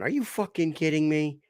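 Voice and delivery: put-on high kid voice; a hint of a bratty little girl; little girl swearing. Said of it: "little girl in the back seat going".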